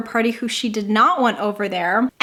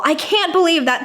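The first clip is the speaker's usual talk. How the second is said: normal talking voice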